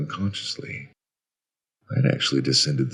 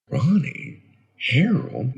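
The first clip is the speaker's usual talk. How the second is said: tentative, raised-pitch murmur of recognition; puzzled echo; 10 semitones higher, slower than usual. Acted. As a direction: repeat the names wonderingly. Narrator puzzles over the screamed names.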